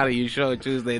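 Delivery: repeating a co-host's sarcastic doubt, understated; lightly mock the sarcastic reply; mimicked sarcasm. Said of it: "The sarcasm".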